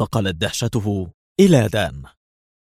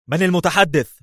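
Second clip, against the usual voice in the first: much higher, curt demand; sharp; 8 semitones higher than usual. A narrator's reading. The ambassador answers the phone, sharp. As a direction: snap it into the receiver. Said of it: "في حدة".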